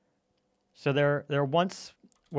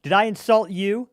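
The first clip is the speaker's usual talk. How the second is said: mock offended; 5 semitones higher, 7 dB louder than usual. Real, not acted.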